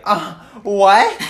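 high, loud, strange-voiced "uh, what?"; hammed-up confusion; obnoxious voice. Shown as a demonstration. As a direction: blurt it across the lecture hall. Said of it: "the most obnoxious voice you can".

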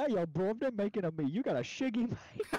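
high, loud put-on British accent; mock British accent. Teasing British viewers in a loud, high British accent. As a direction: ham up a British lad's accent. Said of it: "British people like".

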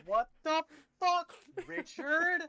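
very high, put-on squawk; mock outraged quote. Friend quoting the video's line, high mock outrage. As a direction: quote it high and loud in a silly voice.

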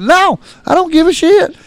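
high, loud, indignant; retold angry refusal. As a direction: refuse point-blank at the top of your voice. Retold loud argument with friends.

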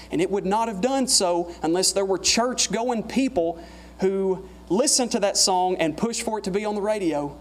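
normal talking voice

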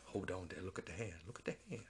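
low coaxing softly; soothing quiet. Retold or imitated. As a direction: hush it like calming an animal. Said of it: "he's like".

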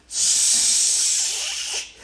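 long sibilant snake hiss; drawn-out "sss". Acted.

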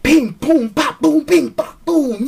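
high, punchy comic-book hit sounds; imitated fight noises. Reviewer describing the superhero fight, imitated blows. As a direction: rattle off mock impact noises. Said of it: "it was like".

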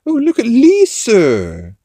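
loud, high, stretched-out cartoon accent; hammed-up accent, drawing the words out loudly; exaggerated high cartoon accent, slow and loud; Stewie. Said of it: "he's doing his Stewie accent".

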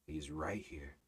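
soft, low, hushed voice; speaking softly. Shown as a demonstration. Preacher showing how softly one can pray, hushed. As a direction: drop to a hush, as if he's right beside you. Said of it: "I can even speak softly, quietly".

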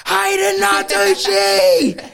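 long, slow yell of a name across the room; drunk yell. Retold or imitated. Drunk guy bellowing a name across the pub, slow and loud. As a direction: bellow the name as loud as you can, drawn out. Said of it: "he just yells as loud as he can".

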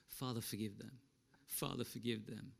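soft, low, prayerful; hushed, tender forgiveness; quiet plea. Retold or imitated. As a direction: pray it softly for their sake. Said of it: "what do we hear him say".

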